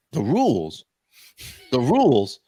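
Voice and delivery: incredulous, punchy repeat of THE RULES; indignant echo. Retold or imitated. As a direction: throw THE RULES back in disbelief, hitting RULES. Indignantly throwing clerk's words back.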